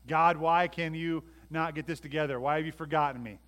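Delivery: loud protest to God; exasperated plea. Acted. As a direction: cry out as one forgotten. Voicing a complaint to God.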